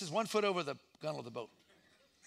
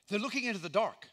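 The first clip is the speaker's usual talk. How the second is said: ordinary talk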